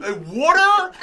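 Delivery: finally getting it, "water?"; incredulous rising "water?"; surprised "water". Retold or imitated. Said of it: "I was like".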